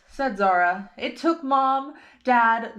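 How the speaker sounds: ordinary delivery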